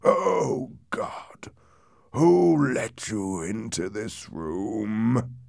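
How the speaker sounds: slow, anguished groaning. A narrator's reading. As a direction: groan it out, drawn long and half-breathed. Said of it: "he groaned".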